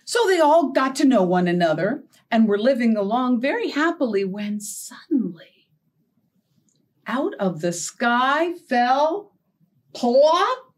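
lively storybook read with big pauses; reading to children. Acted. Storyteller reading a shape story to young children. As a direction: draw the kids in, tale by tale.

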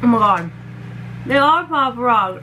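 ordinary talk

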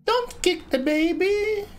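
high, slow squeaky quote; meme voice. Retold or imitated. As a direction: stretch it out in a squeaky voice. Quoting a meme.

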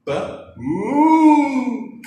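high, loud drawn-out ghostly "moo"; hammed-up ghost wail for kids; spooky ghost moo. Shown as a demonstration. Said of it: "like a spooky ghost".

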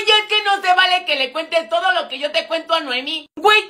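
normal talking voice

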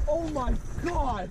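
high, drawn-out exclamation; real slow, amazed cry; overwhelmed amazement. Real, not acted. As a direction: stretch it slowly in high-pitched awe. Overwhelmed by the catch.

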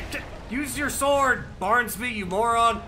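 high, loud scolding shout; yelling at a clumsy ally in battle; exasperated yell. Acted. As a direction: bark orders mid-fight. Yelling at companion in fight.

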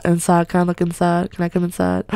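usual speaking voice

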